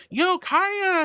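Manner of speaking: high, loud cheerful yo; excited greeting. Real, not acted. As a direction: call out their name with a burst of excitement. Excited shout-out to chatter.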